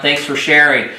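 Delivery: ordinary delivery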